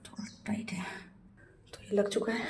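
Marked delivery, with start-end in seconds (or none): 0.0-0.8 much softer whisper under the breath. Real, not acted. Vlogger fitting the pipe on the tap, whispered to herself. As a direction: breathe it while straining.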